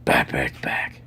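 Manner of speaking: low secret whisper of the line. Pretend. Teasing viewer in the corner, whispered low. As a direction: breathe it like a secret.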